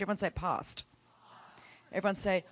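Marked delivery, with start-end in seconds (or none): 0.3-0.8 PAST with a long Australian A; Australian accent. Shown as a demonstration. Preacher getting the congregation to repeat it, Australian accent. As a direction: say PAST as "pahst". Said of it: "to an Australian".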